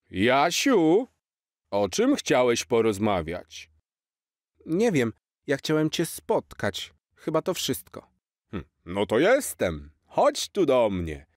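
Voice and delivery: a cheerful old Santa, slightly put-on; hint of Santa. Santa to Jaś on the roof, lightly voiced jolly.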